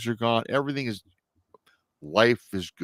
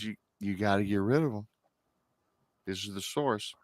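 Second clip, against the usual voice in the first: hushed warning; 7 dB quieter than usual. Retold or imitated. Psychic warns ominously.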